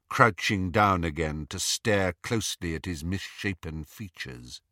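ordinary delivery

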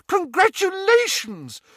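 very high, loud delighted squeal. A narrator's reading. Two women congratulating the new father, squealing. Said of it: "both squealed".

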